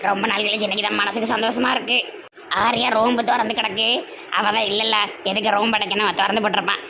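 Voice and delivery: put-on a cunning old mother coaxing her son; scheming mother.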